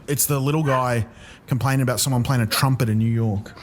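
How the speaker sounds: normal talking voice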